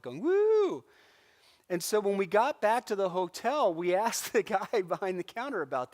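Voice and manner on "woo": high, loud whoop in a put-on voice; imitating a street marcher's whoop; party whoop. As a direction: holler like a reveller in the street. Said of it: "partying and hooping and hollering".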